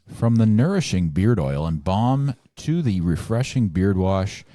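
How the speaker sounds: loud, projected product pitch; ad read. Acted. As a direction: sell it like a commercial announcer. Sponsor ad read.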